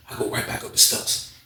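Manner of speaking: ordinary talk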